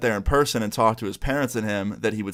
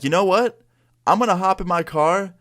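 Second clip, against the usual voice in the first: high, eager burst of a sudden plan; excited; 8 semitones higher than usual. Retold or imitated. Telling viewers his plan to visit the hacker, high and eager. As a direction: pitch it up like a wild idea just hit. Deciding to confront the kid.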